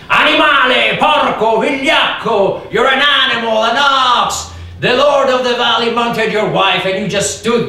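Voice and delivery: loud, high jeering insults; villagers screaming. Retold or imitated. Villagers stoning the family shout insults: loud jeering yells. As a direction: hurl the words like stones. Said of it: "they would scream".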